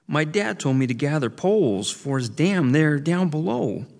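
high frightened little beaver; pleading scared kid. A narrator's reading. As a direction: stammer and plead, afraid.

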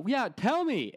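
high mock clerk voice; pharmacist calling out. Pharmacist calls out to him across the store, high. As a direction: sing it out high across the room. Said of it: "So she goes".

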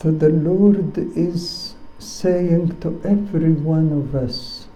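ordinary delivery

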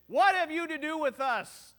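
loud crazed outburst from the front row; demoniac screaming. Retold or imitated. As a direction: yell it like a man bursting into the service. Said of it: "and start screaming?".

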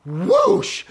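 high, loud ride whoosh; imitated whoosh. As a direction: shout out the rush of a drop. Imitates ride suddenly dropping.

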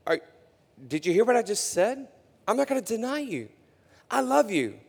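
indignant Peter. Acted. Preacher voicing Peter protesting his love to Jesus, indignant. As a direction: protest that you'd never deny him.

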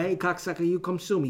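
high, loud tough-guy gangster threat. Retold or imitated. Comic imagining a gangster threatening someone, hammed-up tough-guy voice. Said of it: "something a gangster would say".

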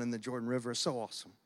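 choked up, trying to keep talking through it; holding back tears. Real, not acted. Pastor tearing up recalling his son's baptism, soft choked voice. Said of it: "I don't know why I'm crying".

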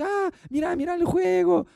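put-on high sing-song coaxing a child; coaxing parent. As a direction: parent distracting a screaming toddler in a high coaxing voice. Parent distracting screaming child.

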